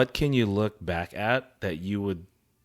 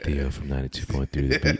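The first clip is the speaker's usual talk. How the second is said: radio DJ voice; 10 semitones lower, faster than usual. Retold or imitated. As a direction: lower smooth radio announcer. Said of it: "that sounded just like him".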